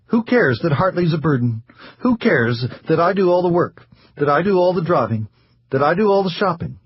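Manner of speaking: high, bitter, self-pitying rant; bitter diatribe. Acted. Wife rants at the doctor about her burdens, bitter and high. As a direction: pour out years of resentment. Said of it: "this diatribe".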